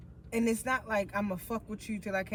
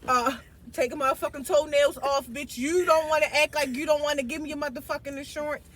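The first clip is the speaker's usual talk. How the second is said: mock rant; 2 semitones higher, 8 dB louder than usual. Mock threats, escalating rant.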